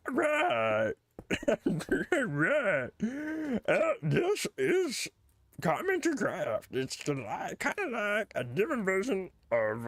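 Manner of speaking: high, slow squeaky cartoon voice. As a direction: keep up the goofy puppet voice. Holds the silly cartoon voice.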